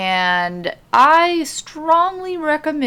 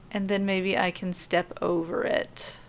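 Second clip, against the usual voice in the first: ordinary delivery, nothing marked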